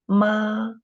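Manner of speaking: "Ma" held high and level; first tone. Shown as a demonstration. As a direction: sustain "Ma" on one high note. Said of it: "first tone".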